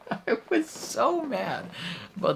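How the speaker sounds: laugh, then wail it in comic fury; exasperated mad. Real, not acted. Viewer mad about the gardener being killed, squealed exasperation.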